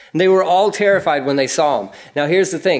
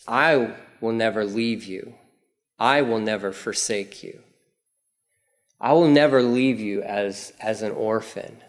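softer, lower reassurance; tender promise; 5 semitones lower, 5 dB quieter than usual. Acted. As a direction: say it gently as a promise. Quoting Jesus' promise.

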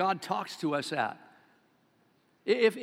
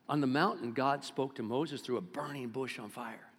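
normal talking voice

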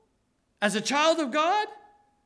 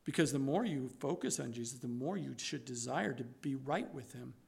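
soft, low, intimate sermon voice; softer pastoral appeal, almost confiding; hushed preaching; 9 semitones lower, 9 dB quieter than usual. Acted. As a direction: draw them in close and quiet.

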